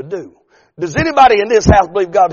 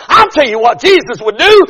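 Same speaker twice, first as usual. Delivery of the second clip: put-on confident kid; 11 semitones higher, 7 dB louder than usual. Boy's confident answer.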